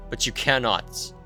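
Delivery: usual speaking voice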